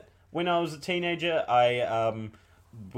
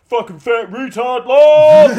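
mock troll; 9 semitones higher, 18 dB louder, slower than usual. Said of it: "rather than being like".

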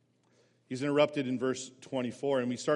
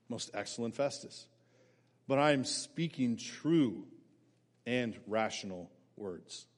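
calm and cool; 5 semitones lower, 4 dB quieter than usual. Acted. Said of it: "Keeps his cool".

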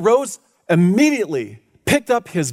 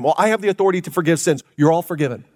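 mock boast; faster than usual. Shown as a demonstration. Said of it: "I can say that to you all right now".

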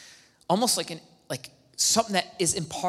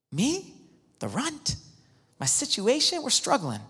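incredulous Gideon; 8 semitones higher than usual. Retold or imitated. Gideon doubts himself.

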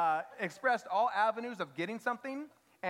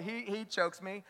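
ordinary talk

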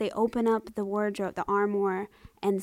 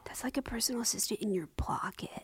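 whispering; 9 dB quieter than usual. Real, not acted. Awed by the phone.

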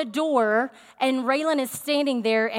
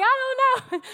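high, frantic mimicked reply; imitating a flustered kid; panicked child; 12 semitones higher, faster than usual. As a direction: answer in a panic, pitch way up. Child's panicked answer in retold scare.

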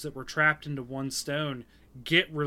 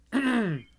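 quick throat clear; 9 semitones higher, 4 dB louder than usual. Real, not acted.

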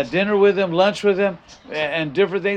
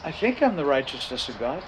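unsure confession; 4 semitones lower, 7 dB quieter than usual. Pretend. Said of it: "You're in trouble if you say".

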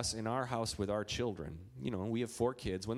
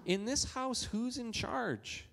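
high, slow teasing question; father asking son slowly, pitched high; parent quizzing child; 10 semitones higher, slower than usual. Retold or imitated. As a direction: quiz a little boy gently.

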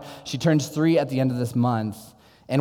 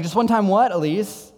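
higher, louder startled question to his daughter; surprised dad; 7 semitones higher, 3 dB louder than usual. Retold or imitated. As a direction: caught off guard, asking her to explain.

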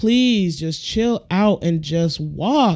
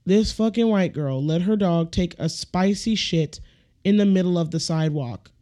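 ordinary delivery

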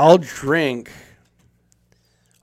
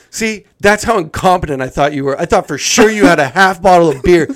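loud, raised-pitch mock tirade; angry scolding, building louder; retold lashing out; 6 semitones higher, 9 dB louder than usual. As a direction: imitate a fed-up coworker tearing into you. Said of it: "He started just lashing out at me".

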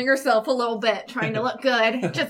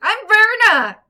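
loud, higher eager shout; calling out; 10 semitones higher, 9 dB louder, slower than usual. Acted. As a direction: call your name up the tunnel. Player calls out to door.

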